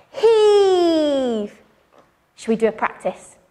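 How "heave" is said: loud straining shout of heave; hauling a rope. Shown as a demonstration. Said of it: "we're gonna shout".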